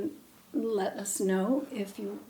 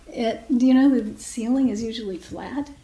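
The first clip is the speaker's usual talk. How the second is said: normal talking voice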